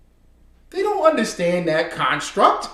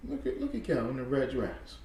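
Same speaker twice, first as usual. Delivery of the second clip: normal talking voice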